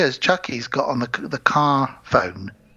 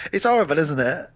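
usual speaking voice

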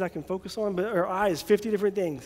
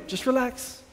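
calm pastor; 5 semitones higher, slower than usual. Retold or imitated. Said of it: "he's very wise and he says".

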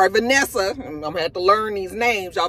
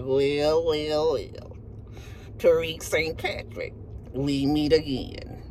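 smug detective; 8 semitones lower, 5 dB quieter, slower than usual. Retold or imitated. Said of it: "She was just like".

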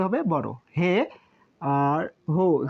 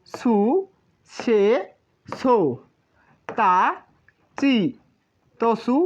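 clear, separate syllables with a pause after each; repeat-after-me drill; 3 semitones higher, much slower than usual. Shown as a demonstration. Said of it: "আমরা একবার উচ্চারণটা করে দিচ্ছি". (শিক্ষকের মতো ধীরে ধীরে আলাদা করে প্রতিটি ধ্বনি উচ্চারণ)

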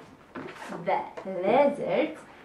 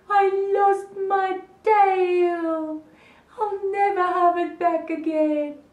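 tearful wail; 7 semitones higher, 3 dB louder than usual. Acted. Gecko telling Dinosaur of his lost tail, play-wailing. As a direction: moan it long and miserable. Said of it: "cried Gecko".

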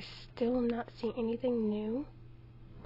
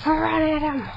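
shout; 4 semitones higher, 10 dB louder, slower than usual. Real, not acted. Urging attack in game.

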